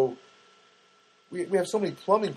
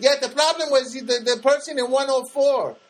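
mock Janet joke; 11 semitones higher, 5 dB louder than usual.

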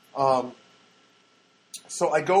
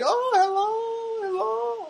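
very high, long drawn-out mock girlish greeting; put-on squealing, slow hello; lighting up; much slower than usual. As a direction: imitate a girl delighted to see you. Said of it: "she lights up when she sees me".

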